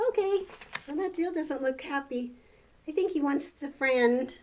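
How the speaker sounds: usual speaking voice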